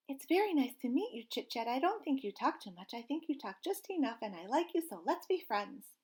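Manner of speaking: a small chick proudly introducing himself; little gosling voice. Acted. Gosling introduces himself to anyone listening, high and eager.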